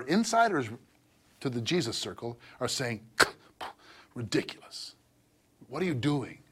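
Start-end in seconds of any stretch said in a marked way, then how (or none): 4.1-6.4 soft, sneering dismissal; quiet mock scoff. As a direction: hush the scorn like an insider. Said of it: "That's the tone".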